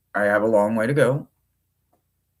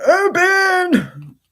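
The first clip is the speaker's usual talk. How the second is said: far higher, loud squeaky "open"; strained squeak; 5 dB louder than usual. Real, not acted. To the stuck deck box, a high loud squeak.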